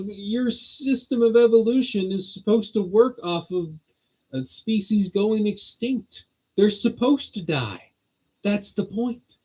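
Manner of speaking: mock lecture. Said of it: "'cause it's like, you know".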